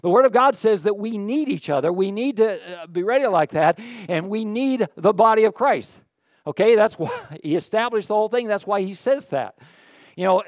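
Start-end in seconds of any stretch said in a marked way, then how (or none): none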